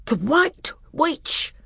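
high, slow startled outcry; high alarmed cry of the name. Acted. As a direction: gasp out the name in shock. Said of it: "cried three voices all at once".

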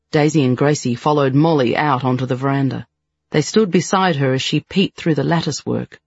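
usual speaking voice

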